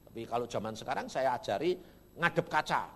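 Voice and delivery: ordinary talk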